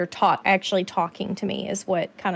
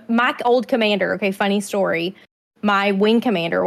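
ordinary delivery

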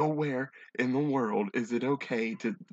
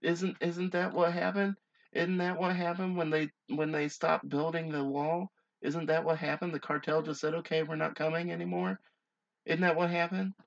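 higher-pitched mock-innocent questioning; sarcastic; 5 semitones higher than usual. Reader mocking the idea to co-host, sarcastic. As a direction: feign naive bewilderment, voice lifted. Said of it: "I'm obviously being sarcastic".

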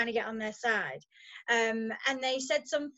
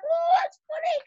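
high-pitched mock giggly kid voice; squeaky mocking pupil impression; sniggering pupils; 3 dB louder than usual. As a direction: mimic a class of kids sniggering, pitched way up. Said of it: "all the little sniggers".

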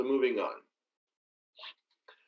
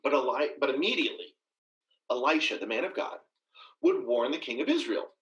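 ordinary talk, nothing marked

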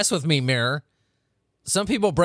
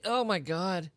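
ordinary talk

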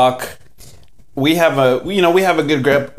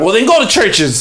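higher-pitched imitation of a fed-up cashier; mock dismissive retort; 8 semitones higher, 3 dB louder than usual. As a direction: toss it back at the complainer, half laughing. Acted curt cashier dismissing complaint.

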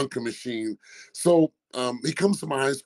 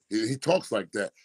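ordinary talk, nothing marked

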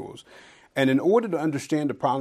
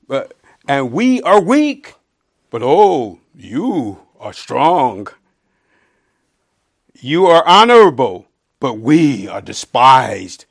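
biting sarcasm; 3 semitones higher, 14 dB louder, slower than usual. Acted. Paul scolding the Corinthians with sarcasm, loud and slow. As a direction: stretch "strong" and "honorable" in scorn. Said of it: "He's using biting sarcasm".

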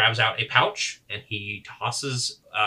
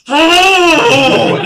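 high, loud drawn-out yowl; loud acted "oh" of pain; pained cry; 16 dB louder than usual. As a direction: let out a long, high howl of pain. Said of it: "and then goes".

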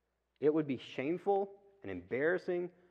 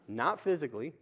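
ordinary talk

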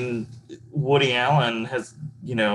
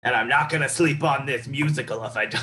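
mock New York accent; 4 semitones higher than usual. Parodying investors waiting for their star, New York accent. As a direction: play a pushy New York investor, hammed-up.

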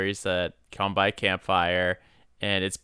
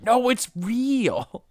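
gullible and over-earnest, high and drawn out; mock wide-eyed pleading, slow, high pitch; Freddie Prinze Junior; 12 semitones higher, much slower than usual. Co-host mocking his campfire story acting, gullible earnestness. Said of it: "he seems gullible".

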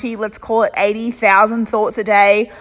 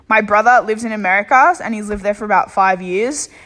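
usual speaking voice

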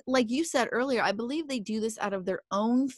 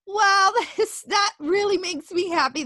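laughing happily through the words, higher and a bit louder; real touched laugh; 9 semitones higher, 4 dB louder than usual. Real, not acted. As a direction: let a moved laugh break in. Touched by a friend's thanks.

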